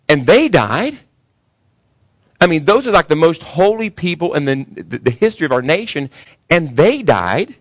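climbing pulpit fervour; fiery preaching. Acted. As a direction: preach it hard to the congregation. Preaching at full force.

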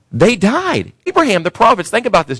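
climbing pulpit fervour; fiery preaching. Acted. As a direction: preach it hard to the congregation. Preaching at full force.

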